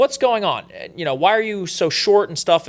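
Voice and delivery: playing his fed-up self at her; annoyed retold question. Retelling annoyed question to girlfriend.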